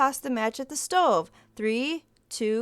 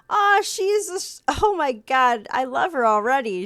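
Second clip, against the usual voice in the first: gushing delight; 2 semitones higher, 6 dB louder than usual. Real, not acted. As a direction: melt over the character. Viewer gushing over a character.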